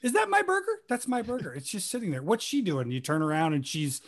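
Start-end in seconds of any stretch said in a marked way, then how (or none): 0.0-2.9 mock impatient diner. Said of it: "sat in a restaurant and gone".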